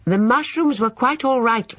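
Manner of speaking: high, loud indignant denial; indignant protest. Acted. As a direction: defend her cooking, stung. Lucy defends her cooking.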